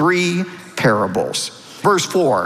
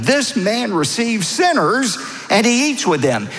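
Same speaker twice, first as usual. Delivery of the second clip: higher-pitched mock grumble; retold indignant grumbling; imitated grumbling; 7 semitones higher than usual. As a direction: voice the objectors' complaint, pitched up. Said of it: "They began to grumble".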